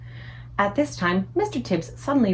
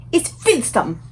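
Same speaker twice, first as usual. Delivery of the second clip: disgusted giant cry; 5 semitones higher, slower than usual. A narrator's reading. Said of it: "cried out".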